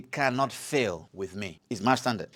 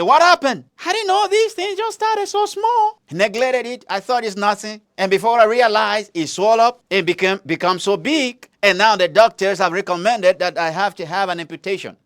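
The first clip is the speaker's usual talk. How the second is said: higher-pitched, pained retelling of the neglected patient; sick man's complaint; 4 semitones higher than usual. Retold or imitated. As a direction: voice the man who ignored his foot. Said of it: "He said".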